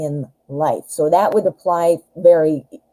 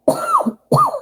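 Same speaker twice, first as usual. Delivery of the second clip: coughing. Real, not acted. Coughing fit from gum.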